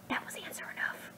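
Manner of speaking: breathy whispered aside. A narrator's reading. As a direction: drop to a whisper.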